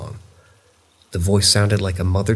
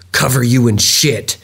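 loud, slow growl, pitched up; 4 semitones higher, 7 dB louder than usual. A narrator's reading. Narrator voicing the growl from the yogurt shelves, loud growling voice. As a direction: growl it out slowly and forcefully. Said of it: "the growling voice repeated".

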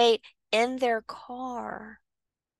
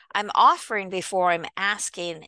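ordinary talk, nothing marked

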